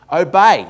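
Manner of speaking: "Obey" loud and high, falling on BAY; over-enunciate "Obey" for a machine; "Obey" in two clear, forceful syllables. Retold or imitated. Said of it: "a bit more clearly".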